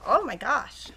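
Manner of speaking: usual speaking voice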